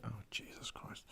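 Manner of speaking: a hushed groan at bad news; despairing whisper to herself; exasperated whisper. Real, not acted. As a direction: breathe it out in dismay, unvoiced. Reacting to grim headline.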